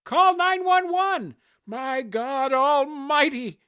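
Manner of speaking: drawn-out, very high yell; alarmed shouting. A narrator's reading. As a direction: holler for help in horror. Bystanders shouting during the attack.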